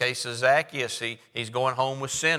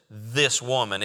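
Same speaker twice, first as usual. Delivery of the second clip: "This woman" with weight on "This", falling; emphatic pointing at "this woman"; stress on this woman; slower than usual. Shown as a demonstration. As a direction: lean hard on "This" in "This woman". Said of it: "there's an emphasis on this woman".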